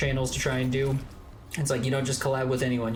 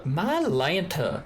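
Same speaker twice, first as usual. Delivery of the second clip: slow, drawn-out Georgia belle drawl; Southern belle accent; 4 semitones higher, much slower than usual. Shown as a demonstration. Showing off the Georgia accent, Southern belle drawl. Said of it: "what you would call the Southern Belle".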